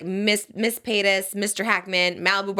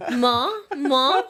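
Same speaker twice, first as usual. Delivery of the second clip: higher British "more", r dropped; "more" said as British "maw"; British accent; 5 semitones higher than usual. Retold or imitated. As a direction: imitate a kid's British "maw, maw". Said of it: "it's very British".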